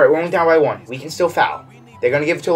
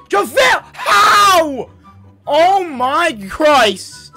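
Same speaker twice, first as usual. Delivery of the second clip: raging gamer; 7 semitones higher, much slower than usual. Real, not acted. Yelling at player.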